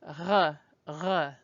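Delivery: R made breathy at the back of the mouth; almost breathy R, lightly aspirated; throaty back-of-mouth R; breathy French R. Shown as a demonstration. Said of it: "it's almost breathy".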